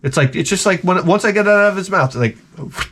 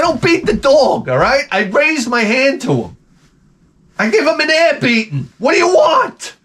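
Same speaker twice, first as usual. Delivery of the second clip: raised pitch building to a mock shout; indignant protest; 9 semitones higher than usual. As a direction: protest in high-pitched exasperation. Indignantly defends himself to critics.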